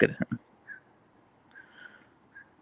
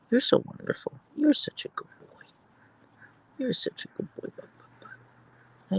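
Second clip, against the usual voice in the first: whispered praise; 6 dB quieter than usual. Real, not acted. Whispering praise to her husky Archer. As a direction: whisper sweet nothings to the dog.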